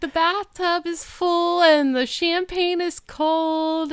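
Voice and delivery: high, slow, seductive purr; sultry voice. Retold or imitated. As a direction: play it sultry and inviting. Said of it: "she has, like, a sultry voice".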